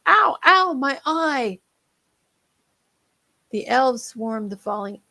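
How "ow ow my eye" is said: high, loud yelps of pain; pained cries. Acted. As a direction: yelp like you just got hit in the eye. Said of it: "echoed in the cries of".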